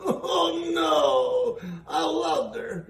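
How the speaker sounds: a laughing wail of dismay; dismayed cry. Real, not acted. Listener mourns Little Red, laughing wail of dismay.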